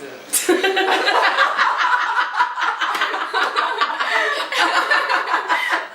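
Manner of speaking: long, high cackling laughter; loud laughing. Real, not acted. Cracking up after the accent demo.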